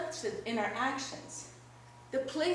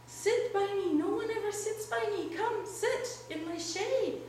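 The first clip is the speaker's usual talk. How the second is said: high-pitched, inviting tree voice; tree calling out; 7 semitones higher than usual. A narrator's reading. Lonely tree invites the traveler into its shade, calling out. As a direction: coax a passer-by in a raised pitch. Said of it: "the tree calls out to him".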